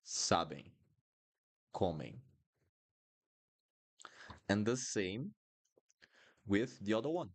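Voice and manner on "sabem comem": sabem, comem falling, lips left open; open nasal endings. Shown as a demonstration. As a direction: end sabem and comem nasal, without closing. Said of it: "You don't close your mouth".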